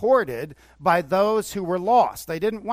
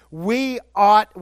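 louder, punchy "We ought"; excited preaching; 5 semitones higher, 9 dB louder than usual. Retold or imitated. John urging support of preachers, loud and excited. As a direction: nail it down with fire. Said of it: "he got excited about it".

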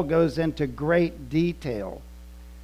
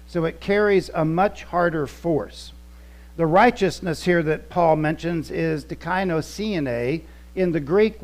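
ordinary delivery

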